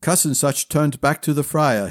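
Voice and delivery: ordinary delivery, nothing marked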